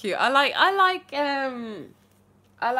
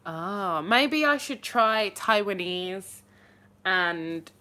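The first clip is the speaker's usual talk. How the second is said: normal talking voice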